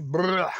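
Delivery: loud rolled spewing noise; mock monster vomiting. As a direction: trill a loud monster retch. Imita al monstruo vomitando lodo. (vómito de monstruo imitado, fuerte)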